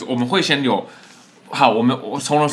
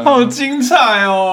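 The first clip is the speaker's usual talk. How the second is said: teasing awe; 11 semitones higher, 4 dB louder, slower than usual. Real, not acted. Friend teases the story.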